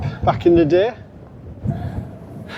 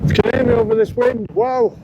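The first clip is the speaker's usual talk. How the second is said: high, raised voice over gusts; shouting over wind; 9 semitones higher than usual. Real, not acted. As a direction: call out over the roaring wind. Speaking on a windy hilltop.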